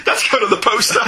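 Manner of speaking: real laugh breaking into the words. Real, not acted. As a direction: say it while cracking up. Laughing at a backhanded compliment.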